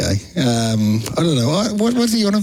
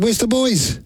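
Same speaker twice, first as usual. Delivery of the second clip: higher-pitched friendly query; lightly voiced restaurant hostess, pitched up; quoted hostess; 10 semitones higher, slower than usual. Hostess greeting a lone regular, quoted higher-pitched question. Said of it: "and she said".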